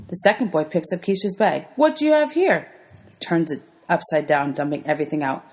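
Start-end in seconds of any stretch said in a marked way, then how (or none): 1.8-2.7 mocking tease. Bully grabs bag mockingly.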